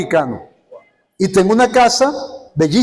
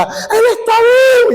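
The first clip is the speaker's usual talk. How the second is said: very high wailing shout, imitated; mother crying; 4 dB louder than usual. Mother hearing her son is alive, high mock sobbing shout. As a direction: imitate a mother bawling with joy. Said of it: "viene la mamá y llora".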